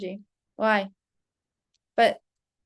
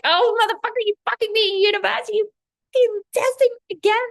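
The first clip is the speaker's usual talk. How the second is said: high, loud, cursing at the universe in jest; laughing mock outburst; 12 semitones higher, 8 dB louder than usual. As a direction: yell it up high like a joking rant at the sky. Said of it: "I'm just laughing at it".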